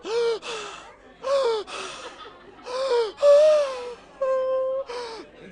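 high, loud gasps imitating an overcome mom; mock tearful gasping. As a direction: impersonate mom with squealed, sobbing gasps. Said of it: "can you do an impersonation".

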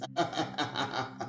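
low, soft staccato chuckle; quiet, drawn-out derisive laugh; mocking laugh. Real, not acted. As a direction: chuckle low in a steady run. Laughing at the played clip.

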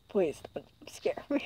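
soft pleading whisper; scared whisper. Real, not acted. Player begs the spooky phone in a frightened whisper. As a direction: breathe it out, afraid. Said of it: "I'm so scared".

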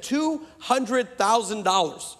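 slow, spaced-out figure; slow admission. Retold or imitated. Doctor telling the preacher what he paid, slow retold admission. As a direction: state the sum word by word. Scholar states the tuition price.

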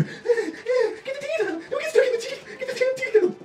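frantic. Real, not acted. Frantic at the game.

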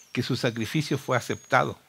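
ordinary delivery

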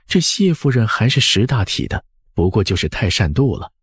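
usual speaking voice

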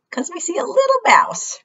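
squeaky little mouse voice; high mouse voice. Pretend. Mouse voice for children.

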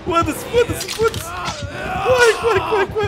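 frantic yelling, run run; panicking gamer. Real, not acted. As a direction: shout urgently while fleeing. Fleeing in game, yelling.

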